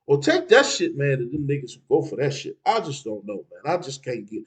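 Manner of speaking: ordinary delivery, nothing marked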